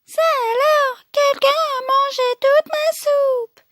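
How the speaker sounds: put-on very high Baby Bear squeal; tiny crying-out voice. Baby Bear finding his soup eaten, tiny high cry. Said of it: "crie de sa toute petite voix".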